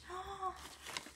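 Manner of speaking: long, soft gasp of delight; thrilled gasp. Real, not acted. Thanking a friend for a gift, thrilled gasp. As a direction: draw in a delighted breath.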